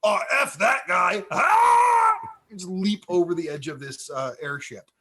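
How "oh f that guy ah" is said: a dumb orc's shout, much higher and louder, ending in a battle cry; hammed-up orc bellowing as he leaps off; idiotic orc yell. Orc lieutenant shouting before jumping off the airship, hammed-up yell. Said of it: "this idiotic orc be like".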